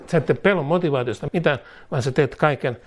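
ordinary talk, nothing marked

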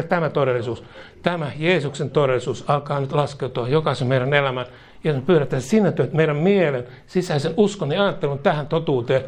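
ordinary talk

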